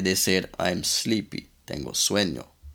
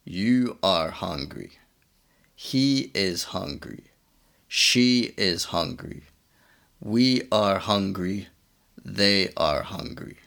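You are hungry, He is hungry, She is hungry said slowly and carefully; slow teaching drill. Shown as a demonstration. Teacher drilling pronouns for students, slow and careful. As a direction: read the drill slowly for learners to repeat. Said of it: "En inglés decimos".

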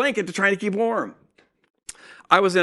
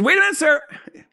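higher, louder imitation of a brusque receptionist; curt receptionist; 10 semitones higher, 4 dB louder, faster than usual. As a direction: brush the patient off sharply, as the busy clerk. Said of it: "She says".

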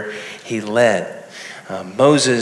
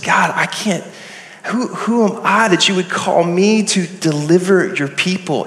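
higher-pitched protest to God; protesting Moses; 5 semitones higher than usual. Acted. Moses doubting himself before God at the burning bush, higher-pitched protest. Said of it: "And Moses said".

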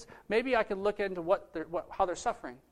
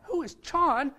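higher, louder bewildered exclamation; baffled; 8 semitones higher, 5 dB louder than usual. Retold or imitated. Sponsor hearing an unknown name shouted at him, baffled. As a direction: act out being totally confused.

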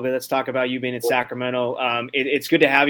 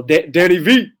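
"Danny V" in a louder, higher mock accent, rising at the end; playful put-on accent; 4 semitones higher, 9 dB louder than usual. Host trying the nickname again, mock accent. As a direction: ham up "Danny V" with a jokey accent. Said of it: "I threw an accent on there".

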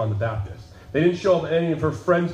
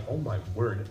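stunned hush; 6 semitones lower, 8 dB quieter than usual. Retold or imitated. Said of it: "Mary was just like".

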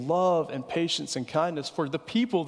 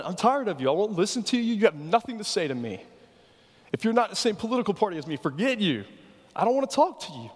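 mock dismissive; 6 semitones higher than usual. Mocking cancel-culture voice.

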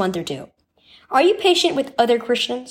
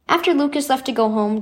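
plain reading voice

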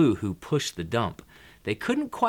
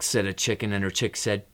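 ordinary delivery, nothing marked

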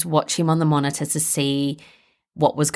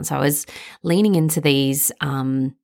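ordinary talk

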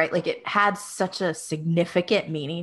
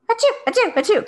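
rapid, high, stifled little sneezes; three tiny quick sneezes held back; much faster than usual. Retold or imitated. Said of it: "kinda like that".

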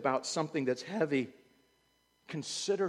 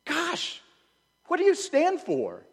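higher, louder frustrated demand; exasperated challenge; 8 dB louder, slower than usual. Pretend. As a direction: shake someone awake with the question. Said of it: "wanna say that to somebody".

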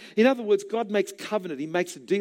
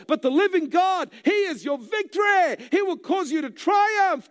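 much higher, shouting God's victory; triumphant preaching shout; 11 semitones higher than usual. Acted. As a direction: build to a roaring preacher's climax. Preacher quoting God, climaxing.

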